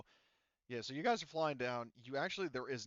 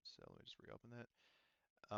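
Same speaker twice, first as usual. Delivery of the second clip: ordinary delivery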